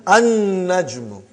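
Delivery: slow "An-najmu" with a long held n; lam silent. Shown as a demonstration. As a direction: drop the l and lengthen the n in "An-najmu". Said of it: "You did not pronounce lam".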